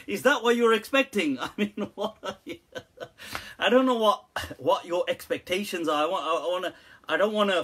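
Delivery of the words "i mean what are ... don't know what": usual speaking voice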